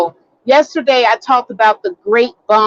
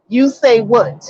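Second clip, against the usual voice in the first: normal talking voice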